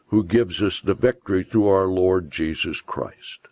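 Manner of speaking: usual speaking voice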